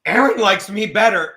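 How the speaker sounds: loud put-on boast; mock bragging, punched loud; mocking imitation. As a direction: imitate a petty brag at full volume. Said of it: "But Charlie's like".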